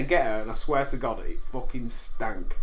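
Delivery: ordinary delivery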